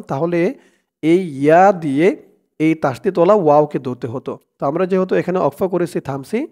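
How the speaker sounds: normal talking voice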